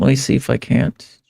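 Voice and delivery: ordinary delivery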